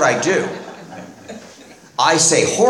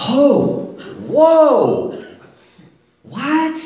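mock shocked whoa; 11 semitones higher, much slower than usual. Shocked at divorce request.

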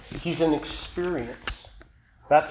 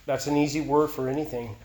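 normal talking voice

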